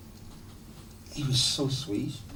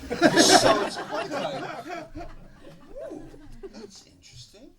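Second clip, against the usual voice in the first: laughing; 6 dB louder than usual. Real, not acted. Laughing retelling.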